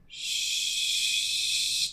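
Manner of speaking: sustained hushing exhale, teeth apart; long shh. Shown as a demonstration. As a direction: blow the air out on a long, steady shh. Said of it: "I want you to make the shh noise".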